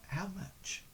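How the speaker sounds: softly leaning in, a quiet offer; leaning in, hushed. Acted. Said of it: "leans forward and says".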